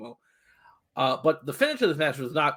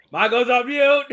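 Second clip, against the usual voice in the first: loud laughing call-out; 9 semitones higher, 8 dB louder than usual. Real, not acted. Calling out to the muted co-host, louder and amused.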